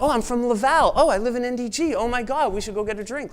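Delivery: thrilled Montrealer abroad, high and loud; gushing excitement. Retold or imitated. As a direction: squeal like meeting an old friend. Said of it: "And they're like".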